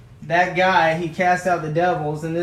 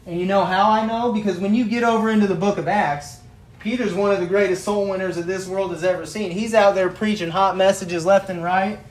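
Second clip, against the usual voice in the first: louder, driving preaching; preaching mode; 3 semitones higher, 4 dB louder than usual. Acted. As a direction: preach it hot to the congregation.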